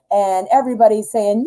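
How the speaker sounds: usual speaking voice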